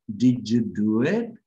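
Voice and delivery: "did you do it" slowed down, did-ju linked; slow demo. Shown as a demonstration. Teacher slowing the phrase so students hear it. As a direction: draw it out for listening. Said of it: "fíjense".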